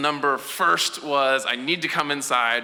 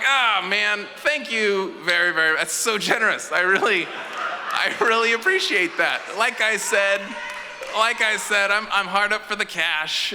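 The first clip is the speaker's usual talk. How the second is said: overly eager refusal; 7 semitones higher than usual. Retold or imitated. Exaggerated polite refusal.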